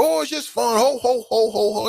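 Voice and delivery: high mocking ho ho ho; sing-song mockery of Santa cheer; mock jolly Santa. Mocking the Santa myth with a high jolly voice. Mocking voice of those fooling you.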